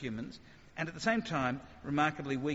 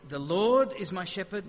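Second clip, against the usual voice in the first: "Lord" louder, rising; stress on Lord; 2 semitones higher, 6 dB louder than usual. Shown as a demonstration. Preacher contrasting stresses for the congregation, "Lord" louder. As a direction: lift and push "Lord". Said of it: "or it's".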